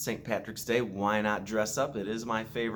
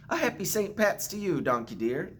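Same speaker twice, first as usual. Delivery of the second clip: Jamie cheerfully greeting; 9 semitones higher, 4 dB louder than usual. A narrator's reading. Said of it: "Jamie said".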